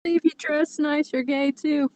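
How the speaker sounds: ordinary delivery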